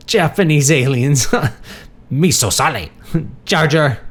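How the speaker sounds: high, loud words broken by giggles; giggly rambling. A narrator's reading. Confused Marty riffing on film aliens, giggling. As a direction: crack yourself up with silly mimicry.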